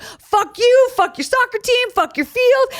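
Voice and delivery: high, hammed-up defiant yell. Teen telling off her coach, high mock yell. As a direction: fling it at him, building. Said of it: "And I was like".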